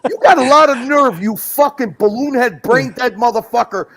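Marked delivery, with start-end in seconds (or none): none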